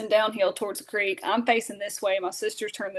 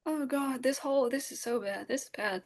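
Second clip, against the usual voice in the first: softer, scared murmur; hushed panic; 2 semitones higher, 7 dB quieter than usual. Retold or imitated. Girl frozen with a turkey inches away, hushed panic. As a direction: panic quietly to yourself. Turkey five feet from face.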